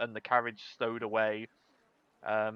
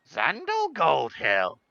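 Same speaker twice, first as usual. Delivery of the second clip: Polly; 3 semitones higher, 5 dB louder, slower than usual. Acted. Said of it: "she looks and goes".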